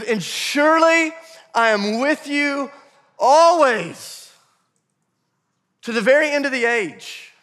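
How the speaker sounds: high, loud, drawn-out declaration; booming proclamation. Acted. Preacher reading Jesus' promise to congregation, booming proclamation. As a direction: proclaim it loudly, stretching the key words.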